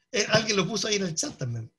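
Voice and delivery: normal talking voice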